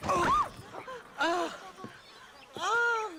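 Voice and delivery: a sudden very high startled oh. Acted. Drunk Irishman caught robbing nuns, startled yelp. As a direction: caught red-handed and yelping.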